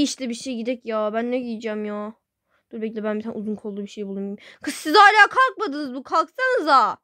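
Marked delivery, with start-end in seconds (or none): 4.6-7.0 higher, louder call with a laugh. Acted. Mother calling sleeping kids to get up, loud and high. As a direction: shout up to the kids half laughing.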